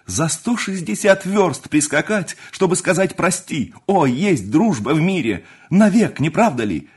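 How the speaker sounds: high, loud, rapturous outpouring; impassioned, exalted praise of friendship; ardent. A narrator's reading. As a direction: declare it with youthful fervour. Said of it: "говорил пылко Александр".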